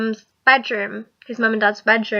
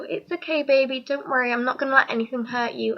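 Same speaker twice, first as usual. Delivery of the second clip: much softer, tender reassurance; comforting whisper-soft; 7 dB quieter than usual. Retold or imitated. Boyfriend comforting scared girlfriend, soft and tender. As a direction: soothe her quietly, like after a nightmare. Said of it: "all she wants then is for you to go".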